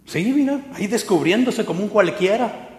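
high scornful sneer; mocking wife. Wife mocking husband back from church, scornful. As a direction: sneer at him like a contemptuous wife. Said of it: "así Mical".

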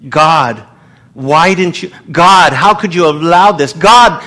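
questioning God. Retold or imitated. A believer in pain crying out to God, rising from hushed to loud. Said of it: "It is human nature to question".

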